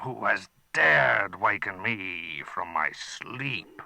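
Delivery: slow, put-on dragon voice; a menacing beast woken; angry dragon. Dragon demanding who woke it, menacing.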